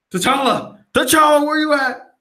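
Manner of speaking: high, loud acted call; mock-frantic searching shout. Acting out calling for vanished T'Challa.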